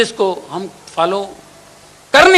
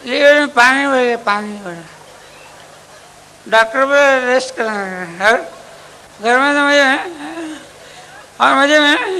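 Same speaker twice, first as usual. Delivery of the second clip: sick husband; 6 semitones higher than usual. Acted. Sick man explains liver.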